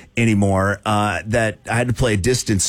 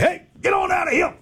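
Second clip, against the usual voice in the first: high, shouted call to shoo the birds; mock holler from the tee box; hollering at geese. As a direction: yell it up high to scatter the geese. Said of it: "hooting and hollering a little bit from the".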